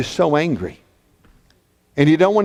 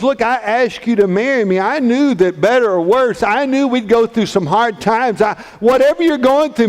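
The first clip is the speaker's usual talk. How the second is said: higher-pitched, insistent pleading as Joseph; impassioned pleading; 7 semitones higher, 3 dB louder than usual. Acted. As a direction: plead earnestly, building in pitch and force. Joseph pleads earnestly with Mary.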